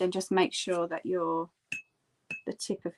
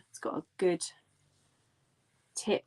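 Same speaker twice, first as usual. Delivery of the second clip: ordinary delivery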